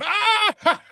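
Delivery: sudden high-pitched yelp of fright; startled shriek. Retold or imitated. Del's startled shout in retold scene.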